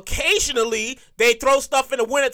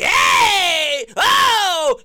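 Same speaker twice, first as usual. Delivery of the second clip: hyped-up shout of a partygoer; party hype; 5 semitones higher, 3 dB louder than usual. Retold or imitated. Partygoers whooping as the function gets lit, hyped shout. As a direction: call out like the function just got lit.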